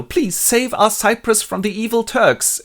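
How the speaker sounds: high, slow, loud plea; hammed-up petition for rescue; pleading Duke. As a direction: implore slowly in a high voice. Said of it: "the Duke petitions to Othello".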